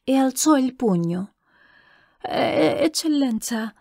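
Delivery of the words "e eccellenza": high, halting, timid address; stammering. A narrator's reading. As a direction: stumble on the first sound, timidly. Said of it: "balbettò Scemaria". (balbettio timido e acuto)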